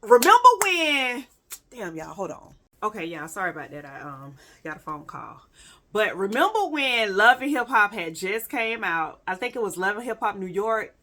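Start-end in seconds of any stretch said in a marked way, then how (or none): 1.7-5.4 soft, quick aside off mic. Real, not acted. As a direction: mumble it away from the mic. Interrupted by phone call.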